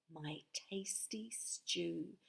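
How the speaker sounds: slow whisper on tasty stew; whispered spell ending. Acted. Witch finishing her cooking spell, slow whisper. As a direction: breathe the last words out slowly.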